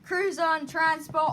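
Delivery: put-on Australian accent; Aussie-British accent. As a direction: play the Aussie bloke. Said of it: "why did I switch my accent to British".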